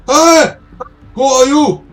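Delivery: high, loud challenge to intruders; alarmed shout. Acted. Old man finding intruders in his house, alarmed shout. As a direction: yell it out in startled alarm.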